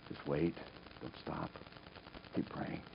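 soft, low reassurance; low, hushed urging; quiet counsel. Retold or imitated. Voices the Lord's quiet counsel.

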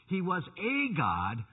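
slow, "a" stretched into a long "ay"; stress on "a". Retold or imitated. Preacher quoting their repeated claim, heavy stress on "a". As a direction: draw out "a" to set it off from God. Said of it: "they keep emphasizing over".

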